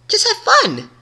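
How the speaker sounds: very high chirpy voice; squeaky cheerful. A narrator's reading. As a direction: squeak it out happily.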